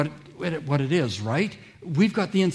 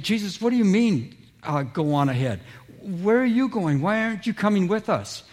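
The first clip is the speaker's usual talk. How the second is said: higher, bewildered questioning; acting out baffled friends asking why; puzzled disciples; 6 semitones higher than usual. Disciples questioning Jesus as he sends them ahead, higher and bewildered. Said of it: "I'm sure the disciples are saying".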